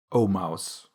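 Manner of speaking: low, falling "oh, mouse"; grammar recitation. A narrator's reading. Alice recalls the last case in Latin grammar, reciting by rote. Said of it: "having seen in her brother's Latin grammar".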